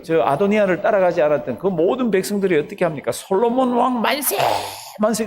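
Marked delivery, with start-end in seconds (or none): 3.1-5.2 acted crowd acclamation. People hailing King Solomon, high imitated cheer. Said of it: "그러면서 막 외칩니다".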